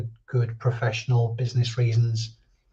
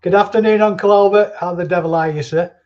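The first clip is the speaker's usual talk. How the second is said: much higher, much louder theatrical hail; hammed-up greeting; 6 semitones higher, 7 dB louder than usual. Hailing Uncle Albert in a hammed-up gentleman voice. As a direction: boom out a jolly mock-formal hello.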